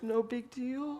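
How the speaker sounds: put-on child trying not to cry; teary little boy. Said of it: "little tears comes in his eyes".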